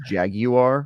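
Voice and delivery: jaguar said as JAG-you-are; three-syllable jaguar. Shown as a demonstration. Explaining own way of saying it, jaguar as JAG-you-are. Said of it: "the way I pronounce that animal".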